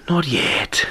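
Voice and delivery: breathy whispered Spanish-accented line; Banderas whisper. Shown as a demonstration. Host demoing Banderas's hushed delivery, whispered. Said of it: "That's how Antonio Banderas talks".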